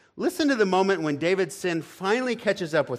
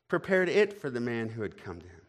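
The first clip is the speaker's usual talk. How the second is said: normal talking voice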